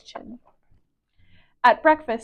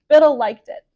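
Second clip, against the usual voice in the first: ordinary delivery, nothing marked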